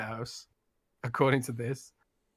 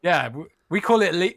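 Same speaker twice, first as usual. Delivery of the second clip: ordinary delivery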